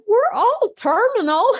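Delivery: quoting herself through a laugh, high. Retold or imitated. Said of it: "I had to start laughing".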